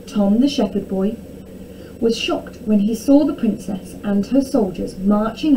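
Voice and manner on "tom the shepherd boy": usual speaking voice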